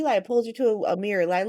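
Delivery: ordinary delivery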